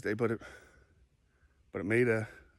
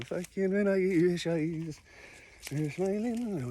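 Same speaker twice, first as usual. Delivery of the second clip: slow, drawn-out mock Celtic warrior, pitched higher; 7 semitones higher, slower than usual. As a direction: drag the words out as an inner Celtic warrior. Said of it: "this Celtic warrior going".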